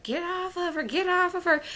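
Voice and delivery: high-pitched cry to break up a fight; retold yell. Onlookers yelling at Erica to let go, high mock yell. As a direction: yell it up high, pulling someone off.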